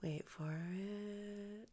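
very slow, hushed whisper; stalling whisper. Real, not acted. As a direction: whisper it and stretch it out. Stalling after losing story thread.

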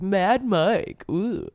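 mock hype-man DJ intro; hyped radio DJ. Mocking DJs who try to sound cool, hammed-up hype. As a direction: play a try-hard radio host hyping himself. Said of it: "trying to always be like".

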